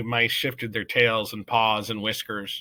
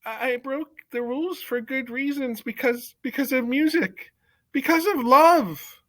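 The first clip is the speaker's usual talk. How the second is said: higher, building louder to the word love; young mouse defending himself before the court, voice raised; 8 dB louder than usual. A narrator's reading. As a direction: push the voice up with conviction. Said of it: "He raised his voice".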